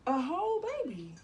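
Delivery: high, loud stress on whole; stunned she's having a whole baby; disbelieving emphasis. Retold or imitated. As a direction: punch the word whole in amazement. Repeated phrase, emphatic.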